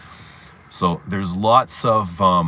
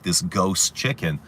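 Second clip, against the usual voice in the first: ordinary talk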